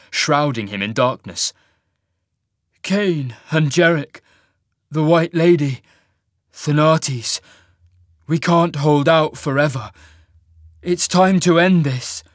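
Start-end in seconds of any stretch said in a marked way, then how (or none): 2.8-12.2 raised, louder voice turning sinister; colder, sinister voice. A narrator's reading. Cole listing enemies as the Reaver takes him, colder and sinister. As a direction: let a cold menace creep in. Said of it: "His voice began to change, becoming colder, sinister".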